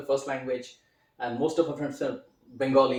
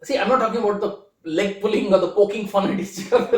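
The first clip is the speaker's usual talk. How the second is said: louder and higher, laughing through the words; 8 semitones higher, 9 dB louder than usual. Real, not acted. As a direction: chuckle about the friendly teasing. Laughing about leg pulling.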